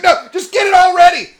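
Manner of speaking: high, loud panicked shout; hammed-up frantic order; mock freak-out. Freaked-out manager tells the teller to prepare, mock panicked shout. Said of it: "The manager freaks out just as bad".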